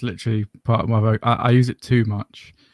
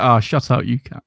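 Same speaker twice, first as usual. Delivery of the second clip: playful, voiced telling-off; light, matey jibe with no bite; jovial banter; 4 dB louder than usual. Shown as a demonstration. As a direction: say it as friendly banter between mates. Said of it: "with a bit of jovialness".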